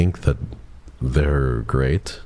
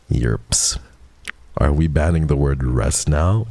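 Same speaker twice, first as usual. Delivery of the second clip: ordinary talk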